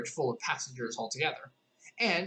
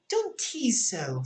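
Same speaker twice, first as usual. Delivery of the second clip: slow, higher, pleading; Alice, teasing protest; 7 semitones higher, much slower than usual. A narrator's reading. Alice scolding the tiny voice she can't find, slow. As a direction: stretch it out, half annoyed. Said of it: "said Alice".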